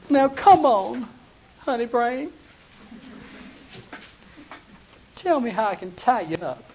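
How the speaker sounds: a hint of sweet coaxing; lightly voiced Delilah coaxing again; wheedling Delilah. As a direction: switch from scolding to sweet talk. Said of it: "Delilah said unto Samson".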